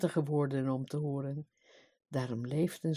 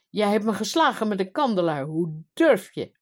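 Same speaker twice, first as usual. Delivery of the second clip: enraged; 4 semitones higher, 6 dB louder than usual. A narrator's reading. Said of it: "riep de koning wit van drift". (driftig en verontwaardigd)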